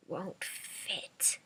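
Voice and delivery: breathy unvoiced whisper. A narrator's reading. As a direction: barely breathe it out. Grandma's broken whisper.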